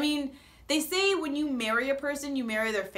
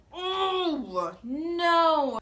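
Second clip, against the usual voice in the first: dismayed oh no; 5 semitones higher, 4 dB louder than usual. Retold or imitated. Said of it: "I'm like".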